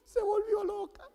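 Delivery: high-pitched stunned aside; bewildered disbelief. Retold or imitated. Husband hugged by crying wife after her yelling, mock bewilderment. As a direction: squeak it out, hammed-up bewilderment.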